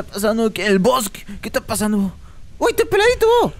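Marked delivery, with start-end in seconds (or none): 2.6-3.5 sudden high yelp at the sight; surprised shout. Real, not acted. Gamer spotting a bald character, surprised high yelp. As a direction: cry out at what you just spotted.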